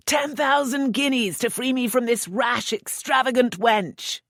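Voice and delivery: laughing mock shout. Earl shouting playfully against the wench, mock outcry. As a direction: ham up the plea. Laughter in his last cries.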